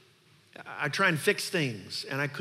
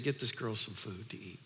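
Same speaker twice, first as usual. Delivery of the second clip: quiet tenderness; 5 semitones lower, 8 dB quieter than usual. Acted. Jesus' tender instruction.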